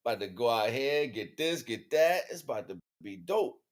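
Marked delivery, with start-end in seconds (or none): none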